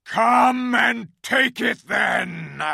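slow, huge bellowing shout. A narrator's reading. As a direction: roar the dare at the witch. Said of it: "in a great bellowing voice".

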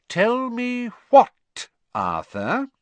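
slow, high, loud menacing demand; dangerous. A narrator's reading. Wife repeating her question to husband, dangerously. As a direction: draw the question out like a threat. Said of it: "in a dangerous sort of voice".

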